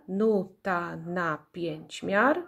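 "nuta na pięć miar" slow, in five beats; syllable by syllable. Shown as a demonstration. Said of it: "ilość sylab w nazwie pokrywa się".

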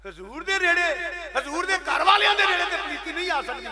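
high, ringing sermon emphasis; emphatic proclamation. Real, not acted. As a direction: proclaim it forcefully to the crowd. Preacher proclaims the point.

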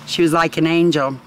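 ordinary delivery

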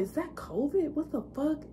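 high, soft and startled, half to herself; a hushed, high shocked question, as if staring at the wall; startled disbelief. Retold or imitated. Retold reaction inside the dream.

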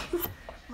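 high breathless squeal of laughter; shrieking laugh. Real, not acted. Shrieking laugh mid spin.

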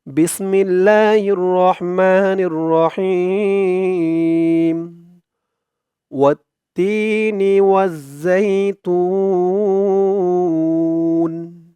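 slow drawn-out melodic chant; Quran recitation. Acted. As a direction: stretch each vowel in recitation. Melodic tilawat of surah. (ধীর টেনে টেনে তিলাওয়াত)

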